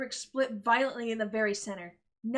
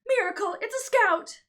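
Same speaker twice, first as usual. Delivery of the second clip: high, loud thrilled call; mother calling Miracle in excitement; excited shout; 10 semitones higher, 9 dB louder than usual. Acted. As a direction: yell it to her daughter.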